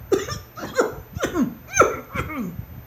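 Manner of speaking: high, spluttering choking fit; acted coughing fit. A narrator's reading. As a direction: cough and choke in a squeaky voice. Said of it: "began to choke and cough".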